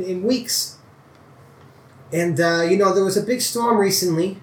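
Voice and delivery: a worried farmer drifting New York; lightly New York-tinged old farmer; New York accent. Acted. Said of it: "I'm doing the New York accent now".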